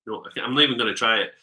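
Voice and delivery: normal talking voice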